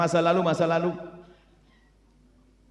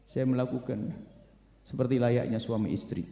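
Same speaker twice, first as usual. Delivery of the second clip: tearful confession; 6 semitones lower, 7 dB quieter than usual. Acted. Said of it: "sambil menangis".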